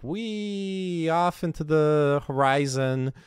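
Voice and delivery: high, drawn-out mock rant; hammed-up soaring cry, slow and high. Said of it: "one of his rants and go like".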